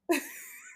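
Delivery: squealing giggle; high-pitched laugh. Real, not acted. Laugh after quote.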